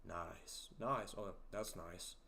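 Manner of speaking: low, soft, understated "nice"; low-key approval. Retold or imitated. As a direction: approve quietly, so understated it could pass for sarcasm. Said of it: "you talk slow and, uh, you know, your low voice".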